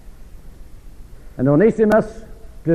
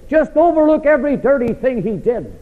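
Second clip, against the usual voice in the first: mock suggestion; 10 semitones higher, 3 dB louder than usual. Mock quote, preacher rising.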